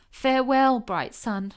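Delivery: high, loud call to the sun; crying farewell. A narrator's reading. Tiny calling farewell to the sun, loud and high. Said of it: "she cried".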